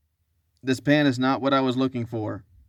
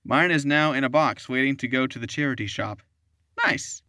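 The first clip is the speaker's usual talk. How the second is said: ordinary talk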